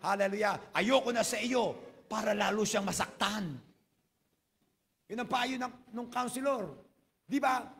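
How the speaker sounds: high, loud, driving sermon delivery; fervent preaching. Acted. Pastor telling the congregation the counselor story, preaching at full force. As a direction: preach it out with rising fire.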